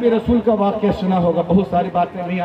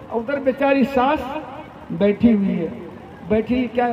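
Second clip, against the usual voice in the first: usual speaking voice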